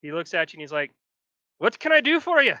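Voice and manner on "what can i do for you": higher, louder eager-to-please guard; charmed guard now beaming at the party; suddenly friendly guard. Acted. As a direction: switch to sweet helpfulness. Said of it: "entire demeanor changes".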